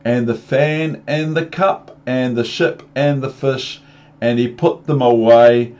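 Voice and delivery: ordinary delivery, nothing marked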